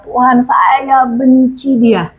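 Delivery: slow, pleading mock lament; drawn out like a wounded cry to God; half-hearted imitation of her crying prayer; retold tearful prayer. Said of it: "akhirnya saya nangis ya".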